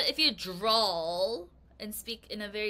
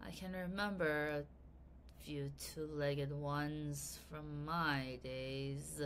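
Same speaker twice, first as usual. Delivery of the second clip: lower, slow lazy drawl; 7 semitones lower, 9 dB quieter, slower than usual. Acted. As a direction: drag the words, low and lazy. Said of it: "Mister Morris drawled".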